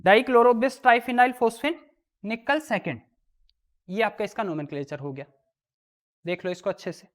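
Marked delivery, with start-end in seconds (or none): 0.0-3.0 very slow, a little louder, every syllable stretched; slow, loud teacher dictation. Shown as a demonstration. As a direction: read it out slowly so they can write it down. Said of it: "ये लो".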